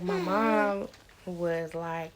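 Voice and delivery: ordinary delivery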